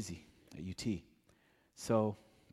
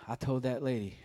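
usual speaking voice

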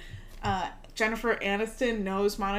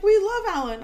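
gushing cheer; 10 semitones higher, 6 dB louder than usual. Retold or imitated. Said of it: "But then they're like".

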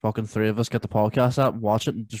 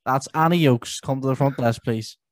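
higher, slow tannoy voice; supermarket announcement; 4 semitones higher, 5 dB louder, slower than usual. Pretend. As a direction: page a customer, drawn out and high. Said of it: "the Tannoy in Tesco's".

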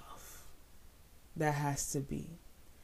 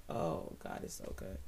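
breathy hushed whisper of awe; whispered praise; 11 semitones lower, 7 dB quieter than usual. Real, not acted.

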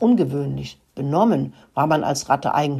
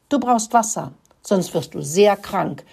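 ordinary delivery

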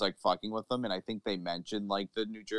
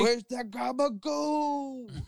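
high, drawn-out wiseguy holler; hammed-up Italian-American bellow; mock Jersey mobster; 4 dB louder, much slower than usual. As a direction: stretch GABAGOOL into a long high cry. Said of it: "Tony Soprano is the New Jersey Devil".

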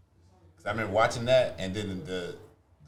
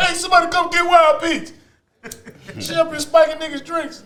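mock call out; 8 dB louder than usual. Calling out jokingly in group.

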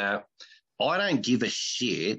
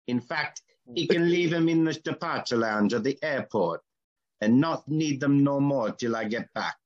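hammed-up Jamaican accent ad read; 3 semitones higher than usual. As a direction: Jamaican accent, pitched up, selling a holiday. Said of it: "I've gotta do it in a Jamaican accent".

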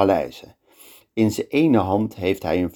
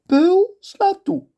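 much higher, louder command; sultan's order; 3 dB louder, slower than usual. Acted. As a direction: order the execution.

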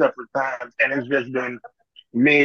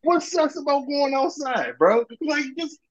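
pitched way up in disbelief; exasperated high-pitched protest; incredulous; 12 semitones higher, slower than usual. Real, not acted. Incredulous rant at friend.

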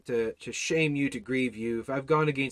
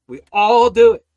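loud; 7 semitones higher, 16 dB louder than usual. Acted. Preacher repeats loudly.

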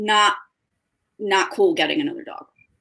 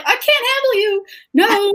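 overwhelmed by puppy; 12 semitones higher, 4 dB louder than usual. Retold or imitated. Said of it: "And I was like".

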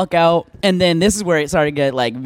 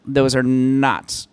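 slow, deliberate; 4 semitones lower, 3 dB quieter, much slower than usual. Retold or imitated. Retold insisting, drawn out slowly.

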